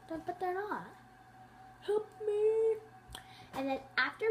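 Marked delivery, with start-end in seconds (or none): 1.8-2.8 playful fake plea, higher; mock cry for help. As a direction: ham up a wounded cry. Pretend wounded cry for help.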